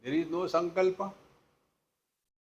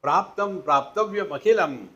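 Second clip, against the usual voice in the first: king's proclamation; 4 semitones higher, 7 dB louder than usual. Acted. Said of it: "the king told him".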